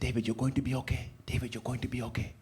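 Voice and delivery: soft, quick reassuring mother; hushed motherly comfort; tender mom voice. Retold or imitated. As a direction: soothe him like a worried mom. Mom reassuring son at car.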